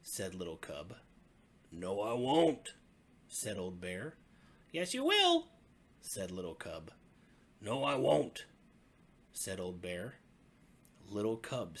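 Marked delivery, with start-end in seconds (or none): 1.7-2.7 put-on Old Bear refusing the cub; stubborn old bear. 4.7-5.5 very high, loud cub insisting; insistent little cub. Acted. 7.6-8.5 put-on Old Bear refusing the cub; stubborn old bear.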